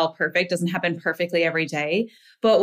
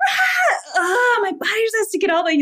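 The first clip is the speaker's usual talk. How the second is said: much higher, crying-out yell; mock scream; 13 semitones higher than usual. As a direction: let a strained wail burst out at the wall. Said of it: "scream at whatever object".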